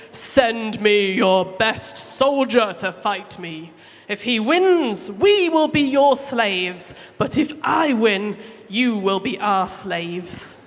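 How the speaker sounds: slow, loud giant's challenge; Goliath shouting. Acted. Goliath challenging God's people, slow loud shout. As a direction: boom it across the valley slowly. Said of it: "he shouted".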